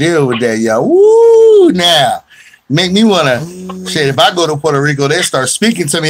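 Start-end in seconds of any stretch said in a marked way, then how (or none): 0.9-1.7 high, loud whooping cheer; loud woo. Real, not acted. Host cheering for Puerto Rican food.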